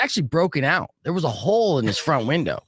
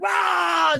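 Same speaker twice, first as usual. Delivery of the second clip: high drawn-out "aah" yell; acted high-pitched holler; mock battle yell. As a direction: yell it out as if hosing someone down. Acting out dumping water on him.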